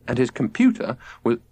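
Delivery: ordinary delivery, nothing marked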